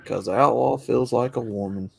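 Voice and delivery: normal talking voice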